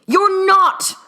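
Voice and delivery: high, loud cry; outburst. A narrator's reading. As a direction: blurt it to stop him. Herman cries out, seizing Newt.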